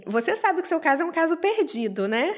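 voz mais aguda, de aviso; advogado imitado. Retold or imitated. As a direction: imite um advogado desenganando o cliente. Imita o advogado dando má notícia. (aviso de advogado imitado, em tom agudo)

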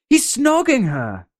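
put-on very high, shrill-free kid squeal; high tattle. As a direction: announce the scandal. Repeated take.

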